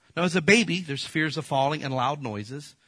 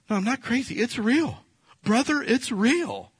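mock excuse-maker; 10 semitones higher, 3 dB louder than usual. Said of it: "People just say that".

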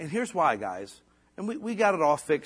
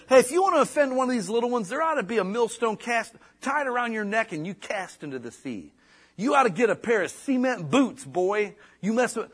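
stern warning; 7 semitones higher, 4 dB louder than usual. Retold or imitated. Jesus retold warning offenders.

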